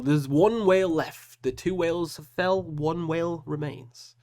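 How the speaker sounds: slow, high, grandly drawn-out proclamation; hammed-up dramatic announcement; mock-epic announcer. Mock-epic announcement of last whale.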